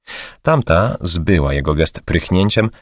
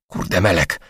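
groaned; 3 dB quieter than usual. A narrator's reading. Woman groaning to herself at her mistake, softer. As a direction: moan it out in dismay. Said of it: "jęknęła".

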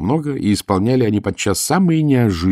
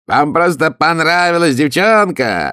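высоко и громко, медленно; выкрикнуть с обвинением, протяжно; крик графа; 9 semitones higher, 5 dB louder, slower than usual. A narrator's reading. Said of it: "закричал он". (граф кричит, грозя пальцем, громко и высоко)

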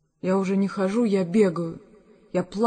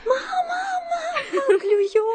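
high excited cry with a laugh; excited shout; 3 dB louder than usual. Pretend. Daughter imagining shouting to her mother, excited laughing cry. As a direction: call out thrilled, much higher. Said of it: "и закричу".

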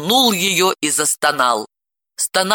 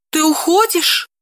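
surprised; 11 semitones higher than usual. A narrator's reading. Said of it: "удивилась она".

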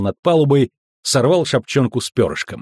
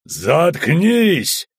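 higher, drawn-out snap; flaring anger; 6 semitones higher than usual. A narrator's reading. Bald guard shaking the boy, flaring up. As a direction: explode at the squealing boy. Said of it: "взвился Лысый".

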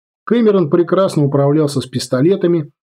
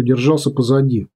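ordinary delivery

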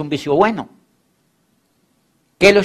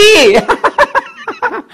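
high mocking laugh; 3 dB louder than usual. Laugh after mocking the young man.

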